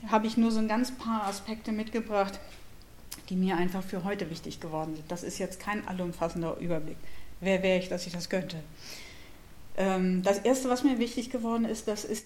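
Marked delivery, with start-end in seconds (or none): none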